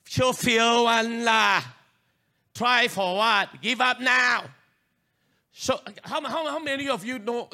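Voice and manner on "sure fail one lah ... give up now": slow, booming taunt of doubt; loud inner voice. Retold or imitated. Preacher booming the voice of doubt at the congregation. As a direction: bellow it like the voice of failure. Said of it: "the voice came very strong to me".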